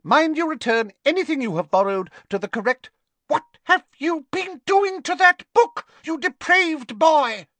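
put-on high, loud severe old librarian; shrill-free high crone librarian, loud; stern librarian. A narrator's reading. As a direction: announce it like a fussy vulture of a librarian. Madame Pince voiced.

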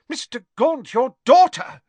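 high, loud alarmed cry. A narrator's reading. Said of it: "said Ogden in alarm".

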